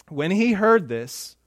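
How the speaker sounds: ordinary delivery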